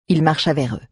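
ordinary delivery

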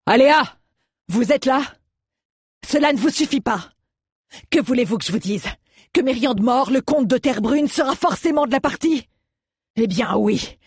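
high, desperate calling to someone out of sight. A narrator's reading. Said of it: "Il avait la voix désespérée". (voix désespérée, qui appelle)

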